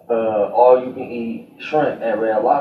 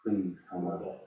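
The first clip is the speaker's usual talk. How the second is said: late night DJ voice; 4 semitones lower, 4 dB quieter than usual. Shown as a demonstration. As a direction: croon it like a midnight radio DJ. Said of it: "late night D J voice for real?".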